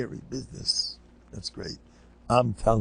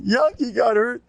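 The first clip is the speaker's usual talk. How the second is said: put-on alarmed child; 13 semitones higher than usual. Kids report again.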